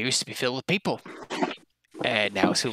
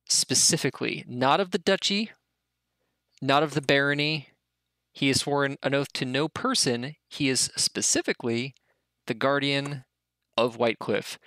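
ordinary talk

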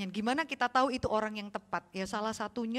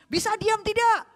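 scolding parent; 11 semitones higher, 8 dB louder than usual. Retold or imitated. Parent scolding noisy kids.

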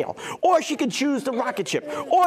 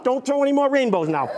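normal talking voice

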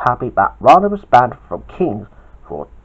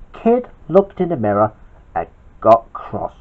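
usual speaking voice

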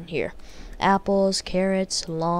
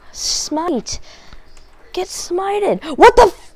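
sudden high angry yell at the screen; furious shout; 10 semitones higher, 6 dB louder, slower than usual. Real, not acted. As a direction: blurt it out in real rage. Furious gamer loses his sword.

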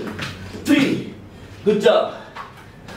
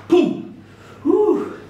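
exertion whoosh; 8 semitones higher than usual. Real, not acted. Exertion cry during workout.

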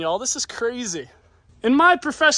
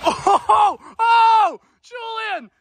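high, thrilled shouting; excited shouting; 9 semitones higher, 5 dB louder, much slower than usual. Real, not acted. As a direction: yell in amazement. Amazed at Julian's climb.

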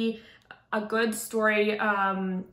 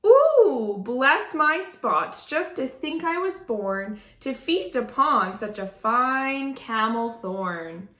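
delighted giraffe; 2 semitones higher, 4 dB louder than usual. Acted. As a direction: swoon over a feast, drawing out the start. Giraffe delights at tree, acted.

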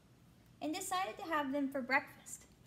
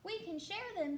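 light, higher friendly bug voice; friendly ladybug voice; 7 semitones higher than usual. Acted. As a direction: greet sweetly like a small friendly bug. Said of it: "suggested the friendly ladybug".

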